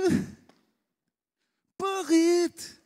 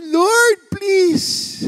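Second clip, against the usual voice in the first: high, loud pleading cry; raised, desperate please; retold tearful plea; 9 semitones higher, 5 dB louder than usual. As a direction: beg God through imitated tears. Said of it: "umiyak ako kay Lord".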